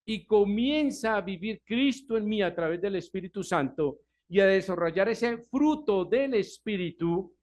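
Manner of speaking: normal talking voice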